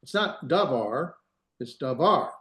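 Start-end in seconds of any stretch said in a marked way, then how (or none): 0.5-1.1 davar with the accent moved onto the first syllable, da; wrong first-syllable stress. Shown as a demonstration. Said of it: "we wanna put the accent". 1.8-2.3 davar with the accent on the second syllable, var; stress on final syllable. Shown as a demonstration. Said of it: "put the accent over here".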